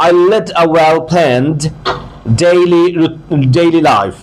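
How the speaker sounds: very slow, word by word; slow demo reading. Shown as a demonstration. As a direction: read it slowly for the class. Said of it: "As a student". (ধীরে ধীরে পড়ে দেখানো)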